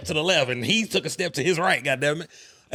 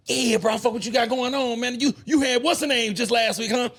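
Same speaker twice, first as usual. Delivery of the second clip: mock fan; 7 semitones higher than usual. Fan voiced, pitched up.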